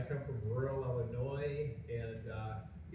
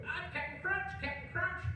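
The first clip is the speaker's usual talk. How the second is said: much higher cartoon squeal. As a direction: squeal it like a sugar-crazed cartoon. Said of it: "he would do cartoon characters".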